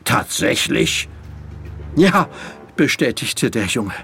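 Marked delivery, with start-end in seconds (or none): 0.0-1.1 sceptical question; dubious, understated response; doubtful. Acted. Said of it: "zog zweifelnd eine Braue hoch". 1.9-2.3 high, insistent yes; raised, youthful urgency; urgent boy. Acted. Breathless boy insists on warning.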